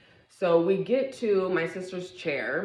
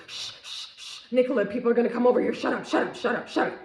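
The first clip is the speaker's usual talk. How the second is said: frantic hush; 6 semitones higher, faster than usual. Retold or imitated. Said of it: "my sister is like this".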